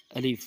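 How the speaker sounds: "الف" short, with no stretched vowel; correct alif pronunciation. Shown as a demonstration. Teacher gives the correct letter, short clean "الف". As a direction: say "الف" briefly, ending cleanly on f. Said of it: "صحیح حرف ہے".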